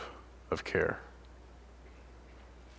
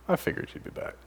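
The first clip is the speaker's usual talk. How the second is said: smug whisper; 9 dB quieter, faster than usual. Acted. Parents greeting repentant child.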